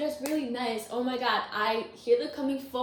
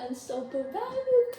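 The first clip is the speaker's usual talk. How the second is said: excited cheer; 12 semitones higher than usual. Acted. Cheering on the viewers.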